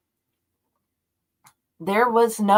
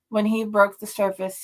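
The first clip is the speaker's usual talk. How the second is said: usual speaking voice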